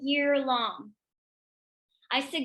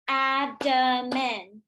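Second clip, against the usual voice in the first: very slow, each syllable of abdomen clapped apart; clapping syllables; much slower than usual. Shown as a demonstration. Teacher leading children in clapping syllables, very slow. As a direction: beat out ab-do-men slowly. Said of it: "we'll say, "Let's clap that".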